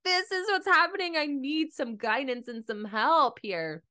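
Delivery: high, moaning plea for help; whiny plea. Retold or imitated. To her naturopath, a high groaning plea for help. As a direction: moan it out to the naturopath. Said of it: "I sound like that".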